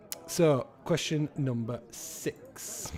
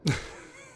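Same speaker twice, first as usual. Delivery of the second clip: breathy, voiceless laugh; short laugh. Real, not acted. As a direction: let out a quick laugh. Laughs at own remarks.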